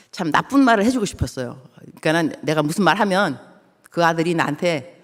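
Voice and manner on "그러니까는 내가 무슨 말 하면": normal talking voice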